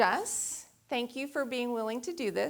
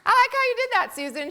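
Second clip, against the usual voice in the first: playful tease; 13 semitones higher, 8 dB louder, much faster than usual. Real, not acted. Teasing Susan from stage.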